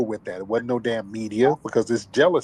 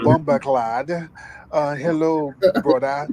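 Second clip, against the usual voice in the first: lightly put-on Jamaican accent, raised, the middle line shouted; mock Jamaican accent; 4 semitones higher than usual. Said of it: "They start talking with that accent".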